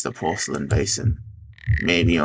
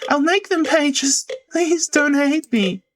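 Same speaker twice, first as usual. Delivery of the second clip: a much higher, pleading voice; desperate pleading character. Acted. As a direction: beg as if about to cry. Character begs the ghost.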